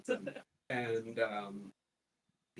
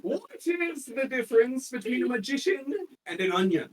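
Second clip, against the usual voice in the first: higher, louder joke telling; comedian's joke; 11 semitones higher, 8 dB louder than usual. Acted. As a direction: deliver the punchline to the crowd. Retelling the comic's joke.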